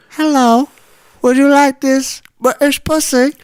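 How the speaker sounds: high-pitched mock British stripper; British accent. British stripper greeting her customers, high put-on British accent. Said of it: "I'm a British stripper".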